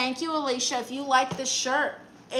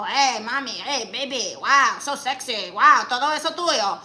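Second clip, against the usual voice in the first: mock leering catcaller, getting louder; creepy catcaller; 4 dB louder than usual. Mocking a Home Depot catcaller to her chat, leering. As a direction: ham up a sleazy street guy. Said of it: "not some guy outside of a Home Depot".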